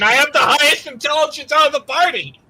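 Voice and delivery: high, loud offended outburst; mock indignation. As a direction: protest as if your intellect was insulted. Mock offence at "little brain.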